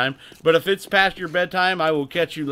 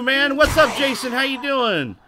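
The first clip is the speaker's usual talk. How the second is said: ordinary talk, nothing marked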